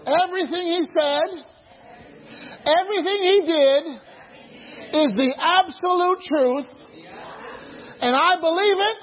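preacher declaring his faith with fervour; fervent preaching. Real, not acted. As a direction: proclaim it with full conviction.

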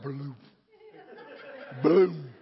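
bloop it low like a rising blob; imitated lava lamp bloop. Shown as a demonstration. Preacher imitating a lava lamp bubbling up, low comic bloop.

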